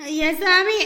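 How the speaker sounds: loud, overwrought thanks; tearful gratitude. Acted. Freed patient thanking the rescuer, tearfully loud. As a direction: cry out thanks as if to a god.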